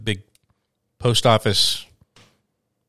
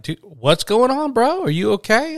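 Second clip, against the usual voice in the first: much higher-pitched mock alarm; acted concern; 12 semitones higher, 4 dB louder than usual. Asking the stammering friend what's wrong, high-pitched concern. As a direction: check on a bro in a high, worried voice.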